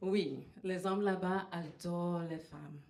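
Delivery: ordinary talk, nothing marked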